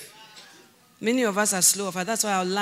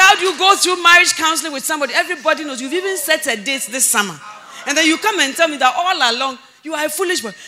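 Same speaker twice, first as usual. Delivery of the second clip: high, raised-voice telling-off that starts fast; indignant scolding; 5 semitones higher, 7 dB louder, faster than usual. Retold or imitated. Mentor reproaching young man over broken engagement, indignant high scolding. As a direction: pile up the reproaches, pitched up.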